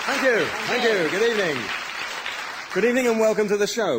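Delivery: high, loud welcome over applause; projected host greeting. Real, not acted. Host greets the applauding audience, loud and high. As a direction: call out to the crowd.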